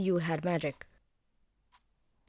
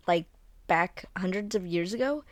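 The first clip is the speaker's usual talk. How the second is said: usual speaking voice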